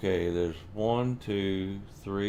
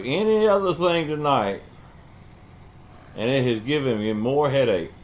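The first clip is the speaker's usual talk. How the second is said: exasperated rant; 5 semitones higher, 5 dB louder than usual. Real, not acted. Ranting about the trailer.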